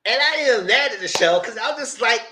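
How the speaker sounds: ordinary talk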